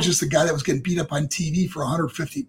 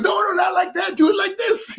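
much higher, shouted correction; coaching shout; 13 semitones higher than usual. Retold or imitated. Dusty Rhodes correcting his student's gimmick, shouted. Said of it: "He goes".

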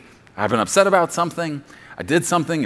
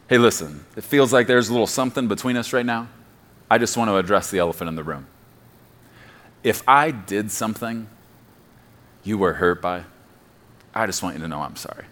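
vague apology; 7 semitones lower, 4 dB quieter than usual. Retold or imitated. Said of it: "you may not know my wife very well".

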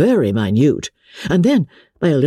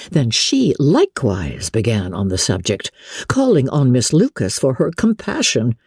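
ordinary delivery

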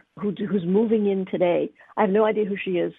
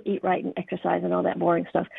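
usual speaking voice

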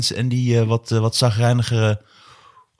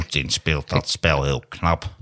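lower, slow grumpy drone; Maarten van Rossum impression; 9 semitones lower, 3 dB quieter, slower than usual. Shown as a demonstration. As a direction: show it lower and slower. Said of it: "je doet hem echt".

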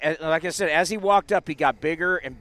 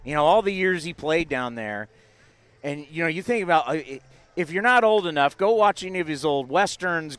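normal talking voice

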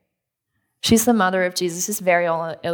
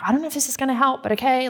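doubtful servant; 6 semitones higher, faster than usual. Retold or imitated. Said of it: "that kind of perspective".

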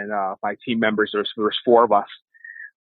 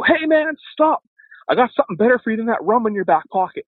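high, loud call after a passerby; preacher hailing a man on the street, loud and high; 11 semitones higher, 4 dB louder than usual. Retold or imitated. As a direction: call out to stop a stranger walking off. Calling out to a passing drunk.

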